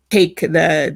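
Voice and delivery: usual speaking voice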